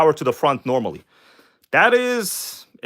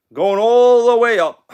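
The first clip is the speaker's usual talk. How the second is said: drawn-out announcement; 7 semitones higher, 5 dB louder, slower than usual. Real, not acted. Heading upstairs with the car.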